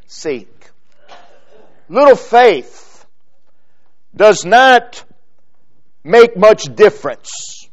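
normal talking voice

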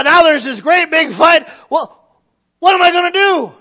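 loud, high, exasperated complaining; flustered churchgoer. Acted. Preacher mimicking a member ranting about a family fight. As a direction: act out someone overwhelmed by family drama.

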